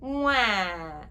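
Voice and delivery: put-on loud whining wah like a baby; baby whine wah. Shown as a demonstration. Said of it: "You can get it pretty loud".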